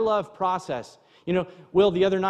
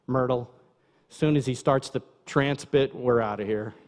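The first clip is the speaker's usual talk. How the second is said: lightly voiced low grumble to a companion; imagined grumbling listener; 7 semitones lower than usual. Congregant privately warning Myrtle they will leave, low mock grumble. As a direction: mutter it low, as a private thought. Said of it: "I hear someone thinking".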